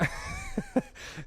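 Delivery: high, soft burst of laughter. Real, not acted. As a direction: break into a high giggle. Laughs after the staring bit.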